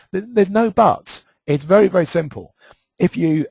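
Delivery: emphatic and a bit emotional; worked up. Real, not acted. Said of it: "I'm getting emotional".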